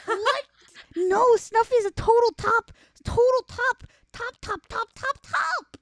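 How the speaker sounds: high, loud, emphatic; excited insistence. Real, not acted. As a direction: insist hard, rising to a squeal. Insisting Snuffy is top.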